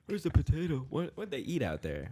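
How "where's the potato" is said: high mock Italian voice; mock Italian accent. Joking about what Poles eat, mock Italian accent. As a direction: pitch up into a joke Italian accent. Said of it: "It's also an Italian accent".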